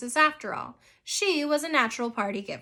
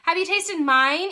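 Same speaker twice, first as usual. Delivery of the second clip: louder, higher demand; pressing, raised-voice question; 5 semitones higher, 6 dB louder than usual. A narrator's reading. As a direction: push the question insistently. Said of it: "Eva demanded".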